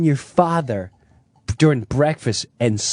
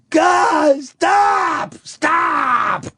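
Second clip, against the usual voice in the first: much higher, pleading cries of stop; father crying out; 13 semitones higher, much slower than usual. Retold or imitated. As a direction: cry out in pain and fear for it to stop. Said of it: "my father going".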